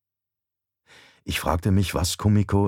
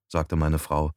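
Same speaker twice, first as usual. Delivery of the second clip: usual speaking voice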